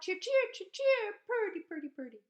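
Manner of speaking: very high, loud chirping; cardinal song. Retold or imitated. Reading the cardinal's song, very high chirps.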